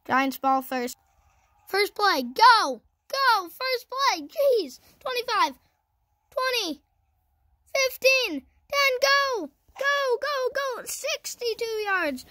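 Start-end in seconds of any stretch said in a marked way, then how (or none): 1.7-12.3 high, loud calls urging the runner on; excited play-by-play. Real, not acted. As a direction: cheer a breakaway run, pitch way up. Calling a long breakaway run.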